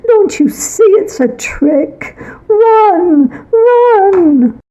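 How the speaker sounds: frantic mother's shriek building to a sob; mother screaming. Acted. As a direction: scream the warning as if to save her child. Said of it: "I could hear my mother scream".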